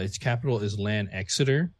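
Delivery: ordinary delivery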